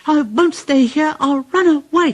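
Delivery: high anxious engine voice; high fretful puffing rhythm; frightened Percy. A narrator's reading. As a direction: chant it like a scared runaway engine. Percy runs off.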